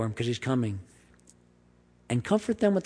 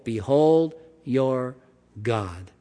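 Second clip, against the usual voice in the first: "Behold" drawn out and falling; slow proclamation; much slower than usual. Acted. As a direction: announce it slowly, like a herald. Repeating the proclamation.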